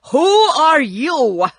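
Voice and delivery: loud, high, drawn-out challenge; crying out. A narrator's reading. Tin Woodman rushing at the little man, crying out. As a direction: call it out slowly and forcefully. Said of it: "and cried out".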